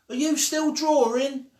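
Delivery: high, loud call across the street; child calling to her friend across the street. Acted. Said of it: "They had to use loud voices".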